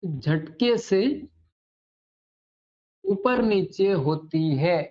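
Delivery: teacher dictating a definition slowly with long pauses; slow dictation. Acted. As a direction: read it out word by word for pupils to write. (धीरे-धीरे परिभाषा लिखवाना)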